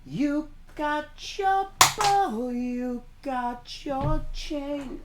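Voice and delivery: very high, very slow, stretched words; drawn-out taunt. Retold or imitated. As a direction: draw each word out high like a chant. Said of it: "I said".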